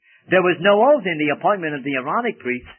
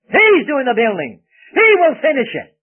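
much higher and louder, triumphant; exultant shout; 9 semitones higher, 9 dB louder than usual. Real, not acted. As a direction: cry out in joyful triumph. Preacher exults over Christ's work.

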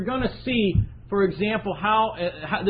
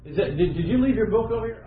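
ordinary talk

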